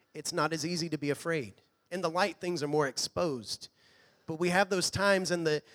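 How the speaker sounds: normal talking voice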